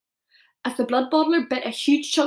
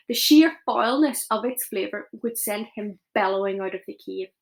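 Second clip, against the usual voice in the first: plain reading voice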